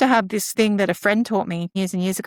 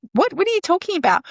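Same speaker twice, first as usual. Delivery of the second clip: high, baffled question; imitating a startled child; child jolted out of a meltdown; confused kid; 10 semitones higher, faster than usual.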